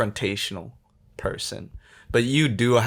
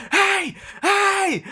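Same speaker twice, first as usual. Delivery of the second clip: high loud calls down the street; shouting; 3 dB louder than usual. Retold or imitated. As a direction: yell to stop a driver. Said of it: "running down the street going".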